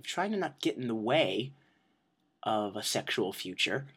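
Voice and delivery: usual speaking voice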